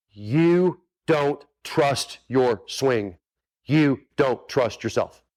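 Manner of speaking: very slow, word-by-word delivery; slow, emphatic. Real, not acted. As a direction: spell it out slowly, pausing between words. Coach drives the point home.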